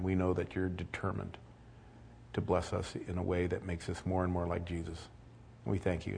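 soft, hushed prayer voice; closing prayer. Acted. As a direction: pray quietly before the congregation. Closing prayer before sermon.

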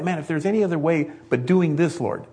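acted frantic prayer for a way out; desperate praying. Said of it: "Have you found yourself praying that?".

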